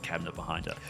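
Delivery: normal talking voice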